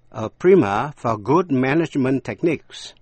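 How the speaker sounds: ordinary delivery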